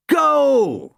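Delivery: high, loud cry of goal; excited shout. Acted. Crowd celebrating a goal, loud high shout. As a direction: yell it as the ball hits the net.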